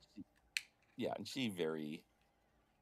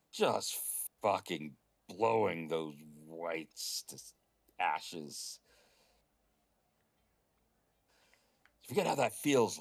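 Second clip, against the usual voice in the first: louder, pumped about battle; fired-up boast; 7 dB louder, slower than usual. Real, not acted. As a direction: relish the carnage. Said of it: "boasting about battles".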